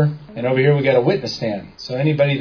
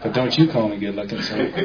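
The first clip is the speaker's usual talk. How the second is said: laughing joke; 4 semitones lower, 4 dB quieter than usual. Real, not acted. Joking aside, laughing.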